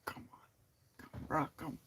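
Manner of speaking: very soft, deflated muttered plea; quiet, half-hearted exasperation; mildly exasperated. Acted. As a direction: mumble a weary protest under your breath. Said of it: "that's the feeling".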